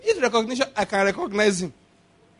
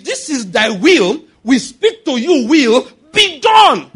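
"This is thy will" declared slow, then "will be done" shouted louder and higher; commanding shout; 6 semitones higher, 8 dB louder, slower than usual. Shown as a demonstration. Pastor showing the right way to pray, building to a shout. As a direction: order it, don't ask it.

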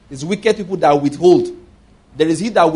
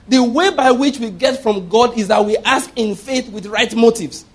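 emphatic, slow teaching point; 5 semitones higher than usual. Real, not acted. Preacher telling the congregation how to get from God, emphatic. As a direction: stretch it out slowly, higher, driving the point in. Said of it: "I need to be emphatic about it".

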